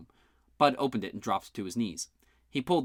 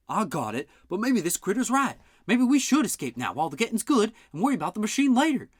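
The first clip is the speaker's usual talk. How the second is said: higher, pushier country voice; raised nervous old-timer voice; 11 semitones higher, 5 dB louder than usual. Acted. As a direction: urge them to run, higher. Bud character, pitched up.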